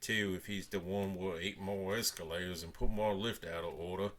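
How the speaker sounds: a hint of grumbling uncle. A narrator's reading. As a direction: grumble suspiciously.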